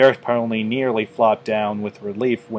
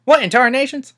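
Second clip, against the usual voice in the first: much higher, louder startled yelp; startled exclamation; 6 dB louder, faster than usual. A narrator's reading. Applejack startled by a voice in her earpiece. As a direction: jump at a voice in your ear.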